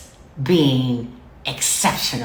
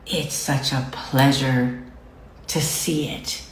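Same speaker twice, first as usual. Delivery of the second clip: acted-out hushed appreciation; awed admiration; 3 semitones lower, 4 dB quieter, slower than usual. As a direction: gasp in delight, then savor it quietly. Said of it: "You should be like".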